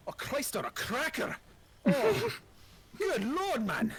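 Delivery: a startled Scottish dwarf, drawn out on good lord; Scottish accent. Acted. Startled dwarf yelps at the stranger, high Scottish accent. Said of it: "my Scottish accent".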